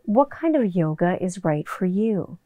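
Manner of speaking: a hint of a gentle ad voice. Shown as a demonstration. Imitating her vocal drop read for the listeners, mildly softened. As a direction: ease into the question like a voiceover. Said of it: "doing something like".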